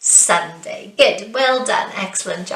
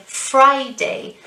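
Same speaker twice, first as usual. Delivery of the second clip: "Friday" with FRI raised; first-syllable stress; 4 semitones higher than usual. Shown as a demonstration. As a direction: lift FRI in "Friday", drop on day. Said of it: "right at the start again".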